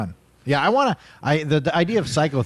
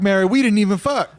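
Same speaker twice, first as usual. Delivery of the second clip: laughing denial; 9 semitones higher than usual. Acted. God denying, laughing.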